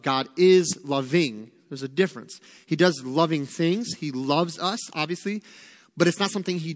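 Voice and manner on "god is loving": is drawn out and weighted, slow; stress on IS. Real, not acted. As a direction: hold the word is in God is loving. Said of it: "we have to understand that God is loving".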